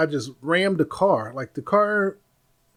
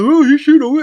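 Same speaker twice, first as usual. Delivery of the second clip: sarcastic retort; 12 semitones higher, 13 dB louder than usual. Retold or imitated. Boyfriend retorts.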